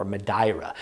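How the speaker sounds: Madeira said quietly as muh-DYE-ra; Portuguese pronunciation. Shown as a demonstration. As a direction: Madeira softly with an eye vowel. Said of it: "never heard before".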